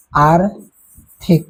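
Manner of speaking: आर्थिक stretched out syllable by syllable; slow dictation. Shown as a demonstration. Teacher dictating a homework word to students, very slow. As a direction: dictate आर्थिक very slowly for the class. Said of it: "dictating homework word".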